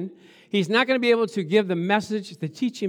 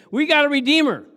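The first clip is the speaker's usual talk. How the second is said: loud, raised-pitch proclamation; defiant declaration; 8 semitones higher, 8 dB louder than usual. Acted. Blind men refusing to stay stuck, loudly declaring. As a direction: shout it as a triumphant rebuttal.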